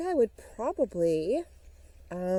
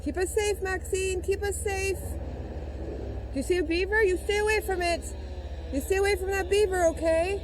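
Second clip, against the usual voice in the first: pleading; 8 semitones higher than usual. Pretend. Pretend fear of beavers, pleading dog.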